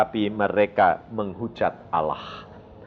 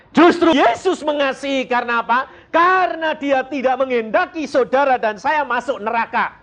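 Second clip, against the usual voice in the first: high, raised pulpit delivery; impassioned preaching; 13 semitones higher, 3 dB louder than usual. Real, not acted. Preacher tells congregation why Jesus loves them, impassioned. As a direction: preach it with urgent conviction.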